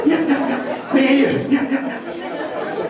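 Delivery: rapid in-breath yeahs; said while inhaling. Shown as a demonstration. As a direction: speak on short sucked-in breaths. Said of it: "do it when they're inhaling".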